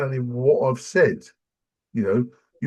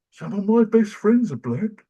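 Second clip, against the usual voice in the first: sarcastic cliché; 10 semitones higher, 5 dB louder than usual. Retold or imitated. Mocking a cliché defence.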